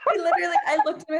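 fast and loud, words spilling through laughter; giggling fast through the story; laughing while talking. Real, not acted. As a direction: rush it out still laughing.

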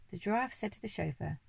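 plain reading voice

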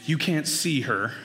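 ordinary talk

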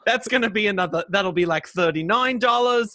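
put-on a clumsy, half-hearted British accent with high laughing bits; British accent attempt, breaking into laughs; bad British accent. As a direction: fumble through a British accent. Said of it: "I can't do the accent at all".

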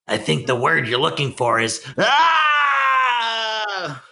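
rising into a high crying yell; Penguin scream. Retold or imitated. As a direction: build to a shrieking wail. Quoting Penguin's scream line.

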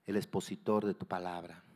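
low, soft, reverent prayer; hushed petition to God with long pauses. Real, not acted. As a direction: pray quietly in short, paused phrases. Opening prayer before the message.

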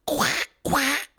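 high quacking cartoon duck. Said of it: "Can you do a Donald Duck impression".

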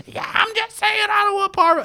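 very high, loud mock yell; yelling woman. Woman in the yard answering the dog owners, yelling. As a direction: imitate the neighbor hollering in her own defense. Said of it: "is yelling at these three people".

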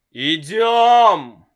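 father yelling at son to come along, loud; shout. A narrator's reading. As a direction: bark the order. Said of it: "снова закричал папа".

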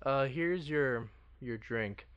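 slow, halting handover; hesitant pause. Retold or imitated. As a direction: pause awkwardly mid-line. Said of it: "pauses as she hands it".

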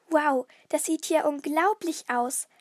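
usual speaking voice

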